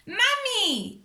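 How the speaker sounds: high, loud call; shouting. A narrator's reading. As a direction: shout for Mummy in alarm. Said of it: "shouted Peppa".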